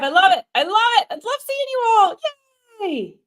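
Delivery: high, loud gushing at viewers; squealing with joy at seeing everyone; excited delight. Real, not acted. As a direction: beam out at the live chat, thrilled. Greeting live viewers excitedly.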